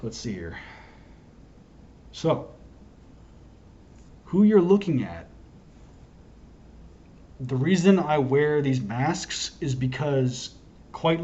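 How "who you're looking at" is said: normal talking voice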